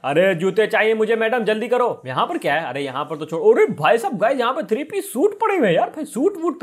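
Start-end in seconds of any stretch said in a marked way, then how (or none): 3.5-6.6 high-pitched delighted discovery; excited amazement. Acted. Player spotting three-piece suits in the shop, excited amazement. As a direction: exclaim it high, thrilled at the find.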